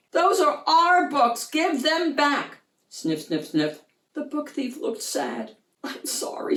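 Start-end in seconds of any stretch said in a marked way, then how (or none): 0.1-2.6 high, loud indignant yell; children yelling. Acted. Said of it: "the children yelled". 5.8-6.6 soft, whispered sorry; sniffling. Acted. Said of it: "he sniffled".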